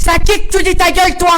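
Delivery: very loud, high-pitched angry yell; acted truck driver yelling. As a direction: bellow it like an enraged trucker. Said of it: "il fait vraiment genre".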